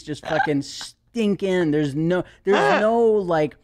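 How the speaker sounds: normal talking voice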